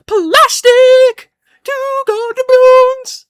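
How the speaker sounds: high, loud, drawn-out cartoon holler; booming high cartoon impression; cartoon quote. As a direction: belt out a mock cartoon villain's cry, stretched long. Acting out a cartoon line.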